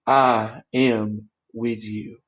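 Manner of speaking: very slow, low, gentle; tender. Acted. Shepherd's voice to the listener, slow and tender. Voicing God's gentle words.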